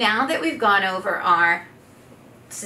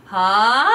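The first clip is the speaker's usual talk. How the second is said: ha sliding upward, louder; gliding ha; 6 dB louder than usual. Shown as a demonstration. Coach demonstrating a rising glide on ha. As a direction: glide the ha up to a high note. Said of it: "glide up to our high notes".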